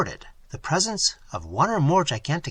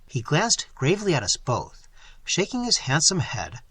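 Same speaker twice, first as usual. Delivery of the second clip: usual speaking voice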